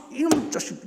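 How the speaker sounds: high-pitched grandmotherly telling-off; mock scolding. Grandmother blaming the ground for a child's fall, mock angry scolding. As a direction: scold in play, high and punchy.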